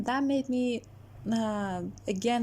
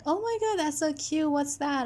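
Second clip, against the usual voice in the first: mock gushing friend; 7 semitones higher than usual. Friend gushing over keychain.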